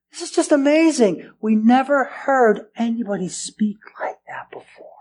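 hushed, amazed whisper; awed whisper. Acted. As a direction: breathe it out in wonder. Awed officers report to priests.

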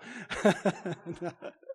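low chuckle at own joke; soft, amused laugh. Real, not acted. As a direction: chuckle quietly after the quip.

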